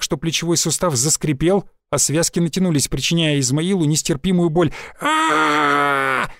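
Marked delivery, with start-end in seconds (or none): 5.0-6.3 drawn-out pained yell toward the door; a slow wailing cry for help; hopeful yell. A narrator's reading. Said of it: "заорал торговец с надеждой". (протяжный крик о помощи)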